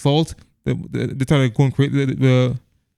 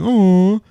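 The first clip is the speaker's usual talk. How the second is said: raised, drawn-out mock "aww"; high, louder cooing "ohh"; audience cooing "aww"; 6 semitones higher, 4 dB louder than usual. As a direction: imitate moviegoers cooing in chorus. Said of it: "other girls in the theater were going".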